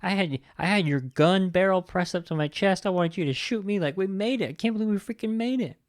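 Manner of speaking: squeaky, hammed-up can't-believe-it voice; mock disbelieving relief. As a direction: act out amazed survivor relief. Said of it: "Ben was just like".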